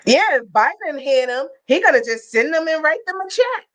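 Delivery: high-pitched mimicry of a ranting man; mock protester rant. Protester ranting at a passerby about Biden, mock agitated. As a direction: imitate his rant, pitched well up.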